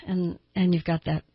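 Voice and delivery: ordinary talk, nothing marked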